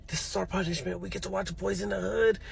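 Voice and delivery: very soft, conspiratorial murmur to a brother; hushed aside. Retold or imitated.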